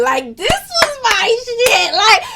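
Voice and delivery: high, slow, gushing; real excitement over the album; excited. Real, not acted. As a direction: squeal it up in delight.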